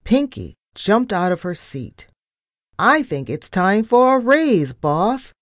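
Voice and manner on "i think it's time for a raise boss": high-pitched, eager mild shout. A narrator's reading. Cheeky assistant demands a raise from the boss, lightly shouted. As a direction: pipe up boldly, pitch lifted. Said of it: "she shouted".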